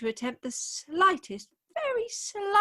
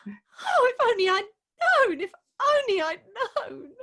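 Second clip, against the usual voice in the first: loud, high, broken sighing lament; 7 semitones higher, 5 dB louder, faster than usual. Acted. Bride mourning lost love, broken lament. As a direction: break off between words in grief.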